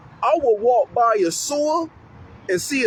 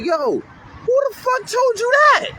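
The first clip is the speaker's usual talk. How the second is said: much higher, slow and loud; incredulous challenge; 13 semitones higher, 3 dB louder, slower than usual. Acted. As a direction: demand where the kid got that idea. Acted question to misled kid.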